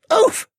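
loud, high grunt on impact; high winded yelp; pained "oof". A narrator's reading. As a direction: land hard with a pained oof. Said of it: "a painful sounding".

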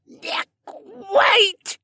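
strangled high croak. A narrator's reading. Boy held up by the throat, a croaked plea. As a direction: squeeze the word out past a choked throat. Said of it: "the figure croaked out".

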